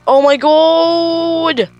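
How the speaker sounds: high, very slow drawn-out cry; frightened wail. Real, not acted. Frightened player watching the game, long high wail. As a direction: wail it slowly in dread.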